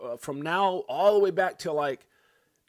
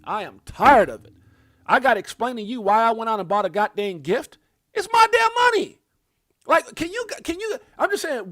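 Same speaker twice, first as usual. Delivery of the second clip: real furious outburst, much louder and higher; angry shout; 4 semitones higher, 6 dB louder than usual. Real, not acted. Ranting to viewers about his bank, furious shout. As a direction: let it explode, yelling at the banks. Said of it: "I am sick and tired of the effing banks".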